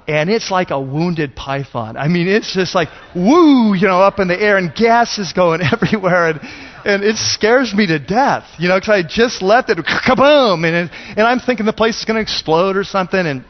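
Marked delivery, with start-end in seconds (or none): 3.2-3.7 loud, high swooping "woo"; imitated whoosh. Pastor mimics the gas hose cutting loose, loud swooping whoop. 9.8-10.7 loud, high "kaboom" sound effect; imitated explosion. Pastor imitates the hose blowing loose, loud explosive kaboom.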